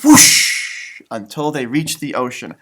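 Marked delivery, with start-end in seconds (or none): 0.0-1.0 breathy whooshing sound effect; playful whoosh for the kids. A narrator's reading. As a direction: blow it out like wind rushing past. Sound effect in read story.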